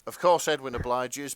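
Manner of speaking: normal talking voice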